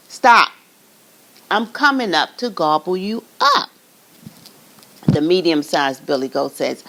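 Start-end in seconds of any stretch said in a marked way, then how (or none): 0.1-3.7 loud, sharp troll stop; troll shout. Acted. Troll stopping the medium goat, loud.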